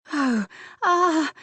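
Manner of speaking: loud, high oh-ah cry; high pleasured cry. A narrator's reading. Woman nearing climax crying out high and loud. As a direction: let the cry break out of her.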